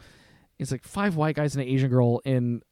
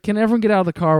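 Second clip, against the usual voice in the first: a louder, higher retold cop's command; cop's loud order; 7 semitones higher, 6 dB louder, much faster than usual. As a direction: bark it like a cop ordering the car empty. Cop orders them out of the car.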